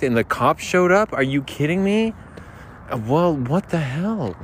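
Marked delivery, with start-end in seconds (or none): none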